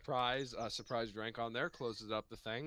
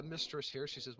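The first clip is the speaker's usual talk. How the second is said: usual speaking voice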